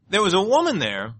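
usual speaking voice

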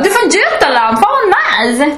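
high-pitched, hammed-up gushing; mock enthusiasm. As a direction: parody an overexcited new acquaintance. Parody of gushing about origin.

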